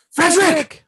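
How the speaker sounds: very high, loud call of the name; mock excited shout. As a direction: yell after him like an excited teammate. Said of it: "the pic's like".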